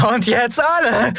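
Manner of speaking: high, strained rallying cry; drunken high-pitched call to join in; bawling singalong call. A narrator's reading. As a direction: bawl it to a whole crowd. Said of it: "wie lange sie vor sich hingrölte".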